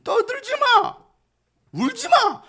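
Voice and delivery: high, loud command; commanding shout. Retold or imitated. Preacher voicing Jesus ordering mourners to stop, loud high command. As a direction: order them to stop crying. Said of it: "예수님의 이 말씀".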